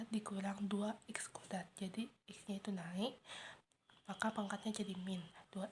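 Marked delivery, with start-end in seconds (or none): none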